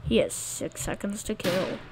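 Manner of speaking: ordinary talk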